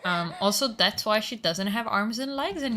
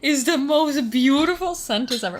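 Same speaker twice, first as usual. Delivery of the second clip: loud, high, delighted exclamation; real gushing excitement, well above usual pitch; excited outburst; 10 semitones higher, 7 dB louder than usual. Real, not acted. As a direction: burst out high and loud, relishing the quoted phrase. Delighted outburst over a silly message.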